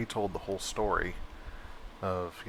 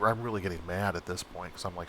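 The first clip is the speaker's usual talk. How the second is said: usual speaking voice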